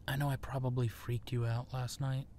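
ordinary delivery

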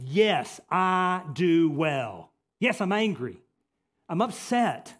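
loud, emphatic YES, then "I do well" drawn out slowly; indignant insistence, punching each key word like "upset". Acted. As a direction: hit YES, ANGRY and UPSET hard. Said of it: "this is very emphatic the way it's written".

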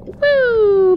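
high excited whoop; excited woo. Real, not acted.

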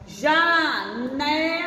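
Janela drawn out slowly, syllable by syllable; slow sounding out. Shown as a demonstration. Teacher to young pupils, sounding out slowly. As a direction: stretch Janela for children to copy. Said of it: "teacher sounds out word for kids".

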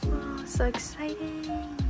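high, drawn-out little coo of excitement; excited. Real, not acted. Vlogger opening a new album for viewers, excited. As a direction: stretch it out high and slow.

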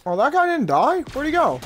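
high, startled disbelief; can't believe he survived; surprised. Real, not acted.

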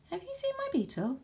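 put-on high-pitched little boy voice; boy's pleading question. A narrator's reading. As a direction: ask it high, like a child. Boy asks about lost beetle.

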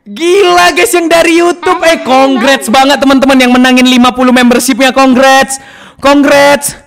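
loud, hyped congratulations; loud congrats. Real, not acted. Streamer congratulating membership winners, loud hyped cheer.

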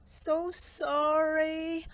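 very slow, high, drawn-out apology; sweetly sorry little mouse voice; apologetic mouse. Acted. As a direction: stretch it out in polite sympathy. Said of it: "said the mouse".

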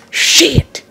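high-pitched exasperated curse; frustrated yelp. Real, not acted. Gamer messing up the crucial turn, frustrated yelp. Messes up the turn once more.

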